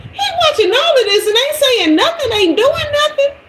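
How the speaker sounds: fast, very high squeal; high-pitched disbelief. Retold or imitated. Storyteller on the neighbor just watching, high incredulous squeal. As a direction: squeal it in comic indignation. Mock outrage at idle neighbor.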